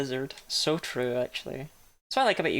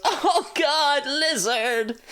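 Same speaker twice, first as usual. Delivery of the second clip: high, louder startled "Oh god, lizard!"; stoned guy's shock; 8 semitones higher, 4 dB louder, much slower than usual. Retold or imitated. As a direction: yelp it like spotting a lizard again. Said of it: "he turns around and goes".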